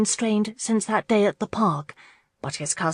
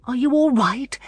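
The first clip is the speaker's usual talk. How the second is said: higher, louder worried question; anxious; 6 semitones higher, 3 dB louder than usual. A narrator's reading. Marlene to Alex, anxiously checking on him. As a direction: fret over him. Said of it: "Marlene asked anxiously".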